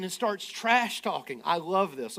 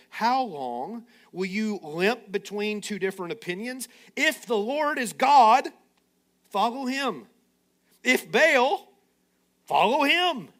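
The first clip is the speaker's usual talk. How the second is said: Elijah's challenge; 2 semitones higher than usual. Acted. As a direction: call the people out, voice climbing.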